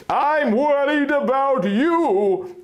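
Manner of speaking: high, slow and emphatic; stubborn retort. Acted. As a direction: insist back at him, won't give in. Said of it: "I retorted stubbornly".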